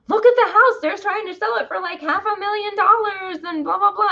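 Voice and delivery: very high, sing-on excited mom voice; high mock-mom chatter, held up to the end; mom impression. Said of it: "Mom was like".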